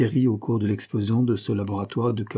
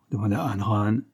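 ordinary delivery, nothing marked

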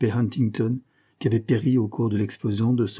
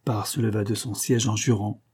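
ordinary delivery

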